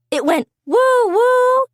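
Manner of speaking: high, slow woo woo like a steam whistle; train whistle. Retold or imitated. Gil telling friends about the whistle, high mock hoot.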